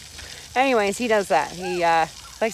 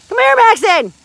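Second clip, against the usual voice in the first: calling out; 13 semitones higher, 14 dB louder than usual. Real, not acted. Mom calling her son over, loud high call.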